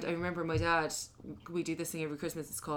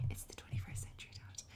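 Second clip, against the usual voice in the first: soft, breathy whisper; whispered retort; 14 dB quieter than usual. Retold or imitated. To her dad at the Christmas pint, whispered. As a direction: hiss it quietly back at him. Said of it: "I was like".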